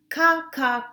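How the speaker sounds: loud, high cawing like a crow. Acted. Teacher voicing the crow for kindergarten, loud caw. Crow's call in read-aloud.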